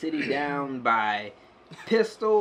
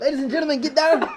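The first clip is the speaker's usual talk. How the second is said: mock alarm call; 9 semitones higher, 5 dB louder, much faster than usual. Mock alarm about a gunman.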